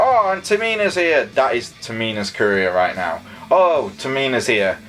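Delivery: normal talking voice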